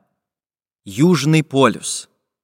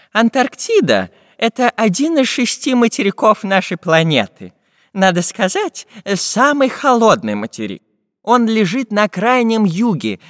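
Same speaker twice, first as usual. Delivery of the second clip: lightly voiced professor, higher-pitched and emphatic; learned professor explaining; 7 semitones higher than usual. Professor tells the travellers about Antarctica, higher-pitched lecturing. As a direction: explain it keenly in a slightly put-on scholar's voice.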